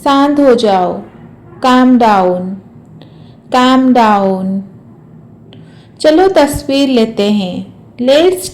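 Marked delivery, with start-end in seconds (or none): none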